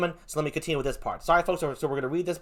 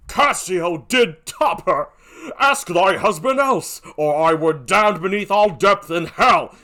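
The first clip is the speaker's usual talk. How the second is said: slow, forceful stage declamation; Othello, vehement; 5 dB louder, slower than usual. Acted. Othello justifies himself to Emilia, vehement and slow.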